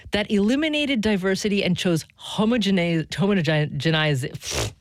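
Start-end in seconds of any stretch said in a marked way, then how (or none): none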